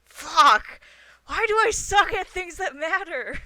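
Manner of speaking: real loud, high-pitched outburst; frustrated shout. Real, not acted. Player cursing a bad persuasion roll, frustrated shout. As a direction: yell it out in exasperation.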